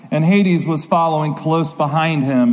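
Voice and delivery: ordinary talk, nothing marked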